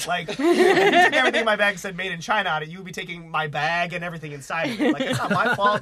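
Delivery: loud protest breaking into laughter; laughing while protesting. Real, not acted. Telling friends the customs story, laughing through the protest. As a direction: crack up while arguing the point.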